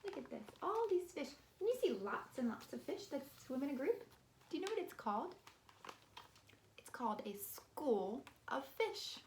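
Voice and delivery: put-on bright, sing-song voice for little kids; kindergarten teacher voice. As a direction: talk to preschoolers, high and animated. Teacher reading to small children.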